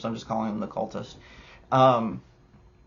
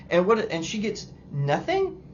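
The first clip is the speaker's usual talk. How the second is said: normal talking voice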